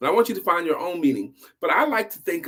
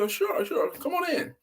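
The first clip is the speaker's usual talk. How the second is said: welcoming pastor; 13 semitones higher, 4 dB quieter than usual. Acted. Pastor voiced welcoming ministers.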